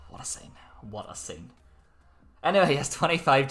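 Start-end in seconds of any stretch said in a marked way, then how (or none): 0.1-0.5 hushed. Acted. Hushed repeated remark. 0.9-1.5 hushed. Acted. Hushed repeated remark.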